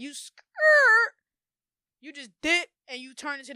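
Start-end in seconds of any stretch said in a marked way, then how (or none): 0.1-1.1 high, loud "skrrt"; tire-screech imitation. Showing her mind peeling away, screeching skrrt. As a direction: squeal it like skidding tires.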